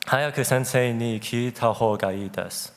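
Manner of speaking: ordinary delivery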